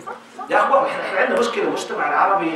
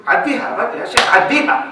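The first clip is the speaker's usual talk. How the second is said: Egyptian-style pleading, louder and rising; mock Egyptian, louder and higher at the end; Egyptian phrase; 5 semitones higher, 4 dB louder than usual. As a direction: urge it Egyptian-style, let it go. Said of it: "على قولة إخواننا المصريين".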